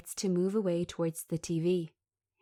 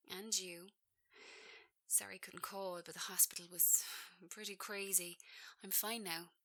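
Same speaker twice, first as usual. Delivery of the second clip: much softer, barely there; quiet, exhausted reassurance; faint tired voice; 14 dB quieter than usual. Acted. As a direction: speak weakly, just out of hospital. Nina weak after hospital.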